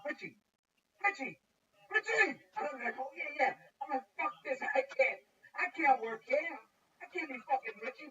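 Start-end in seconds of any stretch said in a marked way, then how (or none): 0.1-2.4 loud, high, repeated summons; calling out. Retold or imitated. Boss calling him all day. 3.0-8.1 loud, higher-pitched griping; exasperated outburst. Retold or imitated. Acted exasperation at being called Richie.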